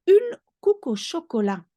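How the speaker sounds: une couque au chocolat pitched high; French phrase. Shown as a demonstration. Teacher naming a Belgian chocolate bun. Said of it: "Une couque is a bun".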